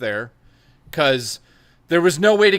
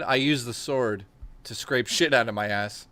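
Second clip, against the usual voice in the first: ordinary delivery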